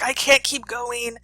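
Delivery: high, slow, loud plea; drawn-out mock desperation; distressed protest. Teacher plays sweating Bob refusing to go on, slow high distressed plea. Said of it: "covered in sweat".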